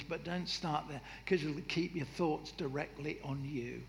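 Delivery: ordinary delivery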